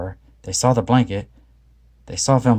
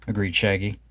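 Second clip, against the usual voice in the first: ordinary delivery, nothing marked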